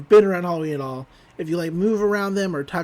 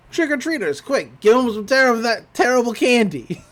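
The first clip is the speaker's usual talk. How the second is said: urgent Stan call; 5 semitones higher, 5 dB louder than usual. Retold or imitated. Stan hears the doorbell.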